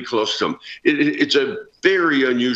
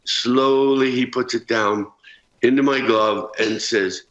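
usual speaking voice

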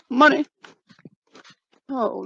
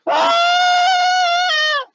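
scream. Acted. Screaming in fright in the woods.

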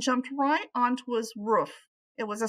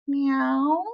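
high rising meow; a cat's questioning meow; 6 semitones higher, 3 dB louder than usual. Acted. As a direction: mew up like a friendly cat. Cat character meows.

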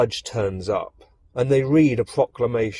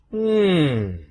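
higher-pitched, musing emperor; kindly emperor; 6 semitones higher, slower than usual. A narrator's reading. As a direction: muse on it with a long hum. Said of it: "looks kindly upon her and says".

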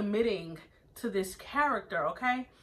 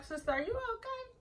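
much higher squeaky mock-concerned "Sister"; worried friend; 5 dB quieter than usual. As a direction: imitate Kelly checking on her friend. Kelly voiced asking friend worried.

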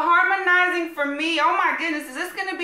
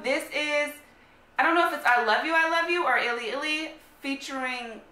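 usual speaking voice

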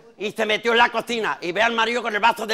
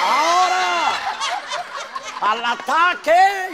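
battle cry; 9 semitones higher, much slower than usual. Acted. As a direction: yell it like charging into battle. Man charges at mother-in-law. (grito de ataque alto)

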